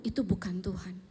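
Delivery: dropped to a near whisper; hushed denial. Acted. Telling listeners where God was not, hushed. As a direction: pull right down, soft and low-key. Contrasts storm with God's stillness.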